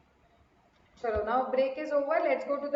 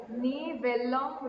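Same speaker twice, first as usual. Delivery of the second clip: नी Welham, very slow with long ल; slow sounding out; 2 semitones lower, much slower than usual. Shown as a demonstration. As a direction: sound each syllable out slowly. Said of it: "इसका pronunciation". (धीरे धीरे उच्चारण)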